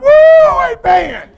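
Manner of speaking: high, loud mock woo amen; Pentecostal shout. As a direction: shout it like a fired-up Pentecostal wife. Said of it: "Pentecostal now".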